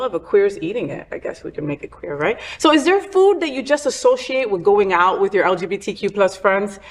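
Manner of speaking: ordinary talk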